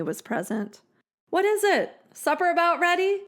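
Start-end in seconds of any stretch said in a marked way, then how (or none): 1.3-3.2 higher calling from a distance; husband calling back, unaware; calling back from outside. A narrator's reading. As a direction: answer cheerfully from the yard. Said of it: "Asa called back".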